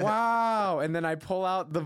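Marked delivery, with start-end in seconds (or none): 0.0-0.8 higher-pitched put-on wow; feigned amazement. Entertaining Fertone while waiting, feigned amazement. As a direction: humour the storyteller with awe. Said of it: "I go".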